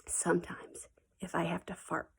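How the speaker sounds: hushed confiding whisper. Shown as a demonstration. Teacher admitting a secret to her class, whispered. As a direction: whisper the embarrassing part. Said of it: "tell you a little secret".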